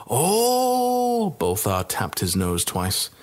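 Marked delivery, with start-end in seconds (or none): 0.1-1.4 a drawn-out knowing oh, much higher; knowing innkeeper. Acted. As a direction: innkeeper catching on, playful.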